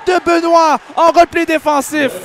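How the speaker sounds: rising, loud rush call; excited play-by-play. Real, not acted. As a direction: build up to the big play. Rush and big save. (description enflammée, de plus en plus aiguë et forte)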